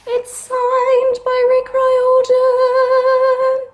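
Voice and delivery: high, loud, slow squeal of delight; squealing over the signature; thrilled squeal. Real, not acted. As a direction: stretch it out in sheer joy. Thrilled discovery.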